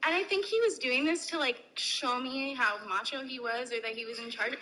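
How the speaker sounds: normal talking voice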